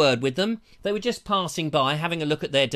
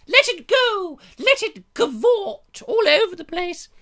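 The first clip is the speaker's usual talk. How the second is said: put-on high, loud, slow haughty royal old lady; drawn-out regal warble, hamming it up; over-the-top queen voice; mock Queen; 13 semitones higher, 5 dB louder than usual.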